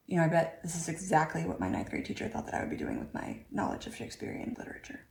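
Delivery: fading aside. Real, not acted. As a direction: mutter it fast, then whisper the end.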